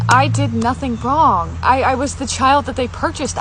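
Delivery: real tears welling up; tearful. Real, not acted. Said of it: "And it brought me to tears".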